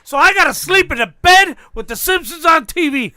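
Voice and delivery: high, loud chest-thumping; hammed-up breadwinner bluster; mock macho rant. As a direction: shout it like the proud man of the house. Mock macho rant about working.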